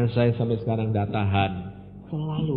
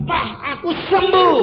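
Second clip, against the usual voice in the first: high, loud cry of joy; mother's shout; 9 dB louder than usual. Retold or imitated. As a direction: shout that she is healed. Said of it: "dia berteriak kepada ayah saya". (ibu berteriak gembira kepada ayah bahwa ia sembuh)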